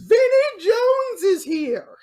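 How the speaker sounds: high, loud, drawn-out guest announcement; hyped announcer. Pretend. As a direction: herald the star slowly at full volume.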